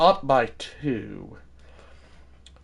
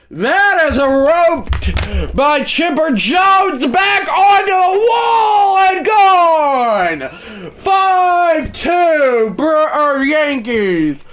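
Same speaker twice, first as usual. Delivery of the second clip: shouted, much higher, slow broadcaster call; home run call; 13 semitones higher, 6 dB louder, much slower than usual. Acted. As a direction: scream it off the wall like a play-by-play man. Announcer calls a home run.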